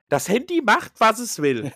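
hohe, etwas lautere Mutterstimme; Mutter nachmachen. Retold or imitated. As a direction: die Mutter hoch nachäffen. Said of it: "meine Mutter sagt auch immer". (hoch nachgeahmte Mutter)

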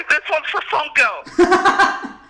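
very high, slow rallying cry; high-pitched showman's cry; mock dedication shout. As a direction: holler a hammed-up tribute through laughter.

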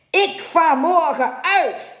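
high, loud, slow captain's roar; defiant bellow, dragged out; shouted defiance. A narrator's reading. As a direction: bellow it at the mate as a challenge. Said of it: "schreeuwde hij tenslotte tegen de stuurman".

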